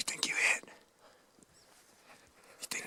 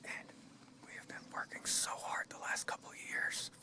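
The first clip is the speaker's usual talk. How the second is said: whispering; 5 dB quieter than usual. Real, not acted. Whispering on a hunt.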